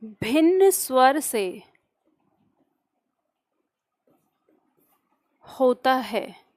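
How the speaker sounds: slow dictation with long pauses between phrases. Acted. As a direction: dictate word by word for note-takers, drawing out each sound. Dictates definition to class. (धीमे-धीमे, रुक-रुक कर श्रुतलेख)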